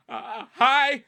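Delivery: high, loud, nervous "uh, hi"; scared greeting. Retold or imitated. Stranger gets in her car, she greets her nervously. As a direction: squeak it out politely while scared. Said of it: "And I'm like".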